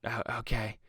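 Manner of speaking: hushed, underwhelmed audience whisper; imitated whisper. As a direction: lean over and whisper a lukewarm reaction. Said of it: "whisper and, like".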